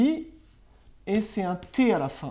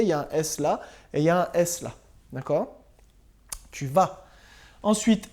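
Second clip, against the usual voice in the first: usual speaking voice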